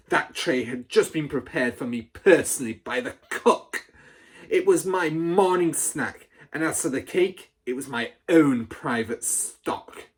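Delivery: loud, high, outraged accusation; furious rant. A narrator's reading. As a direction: rant at the boy before the school. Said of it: "the Trunchbull shouted".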